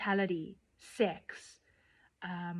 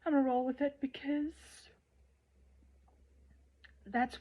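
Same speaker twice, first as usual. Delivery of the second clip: emotional; 4 semitones higher, 3 dB quieter than usual. Real, not acted. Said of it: "I don't know why I'm getting emotional".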